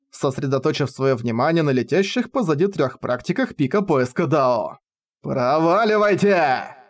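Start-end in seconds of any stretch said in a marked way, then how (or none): 5.2-6.7 slow roaring brute; roaring grunting brute. A narrator's reading. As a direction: roar it out slowly like a brute. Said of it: "взревел старик".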